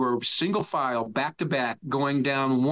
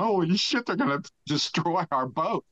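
mock panicked, higher-pitched exclamation; retold alarm; 4 semitones higher than usual. Crew hearing the deck crack, retold alarm. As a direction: act out a touch of alarm.

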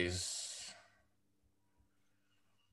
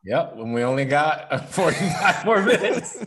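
laughing; 6 semitones higher, 7 dB louder than usual. Real, not acted. Laughing at the short airtime.

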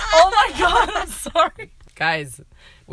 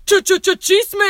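stuttered hype sting, higher and louder; jingle shout; 9 semitones higher, 5 dB louder than usual. Acted. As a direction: punch it like a show jingle. Jingle-style segment intro.

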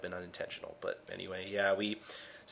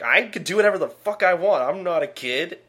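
higher, louder defiant outburst; defiant shout; 8 semitones higher, 5 dB louder than usual. Retold or imitated. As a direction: snap back like a teenager. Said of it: "And he's like".

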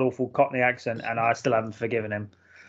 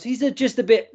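quoted film line; 10 semitones higher than usual. Retold or imitated. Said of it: "when he goes".

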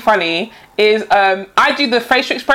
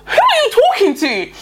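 much higher-pitched baffled question; incredulous retold thought; 9 semitones higher than usual. Wondering at a woman talking with no one responding, incredulous. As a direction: ask it in high-pitched disbelief.

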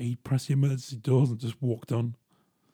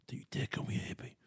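hushed, playful warning; mock threat whisper; 13 dB quieter than usual. Whispering a mock threat at the viewers.

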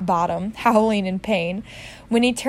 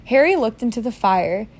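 plain reading voice

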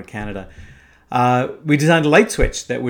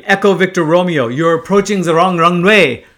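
loud, raised-pitch radio rebuke; angry yelling; 5 semitones higher, 9 dB louder than usual. Retold or imitated. Air traffic controller yelling at an off-course pilot, angry. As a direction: yell it like an irate controller. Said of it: "an angry French voice started yelling at me".

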